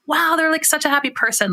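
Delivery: high-pitched wow at someone's cheer; impressed reaction. Acted. Host imagines hearing a happy voice, impressed and high-pitched. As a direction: voice an impressed listener, pitched well up.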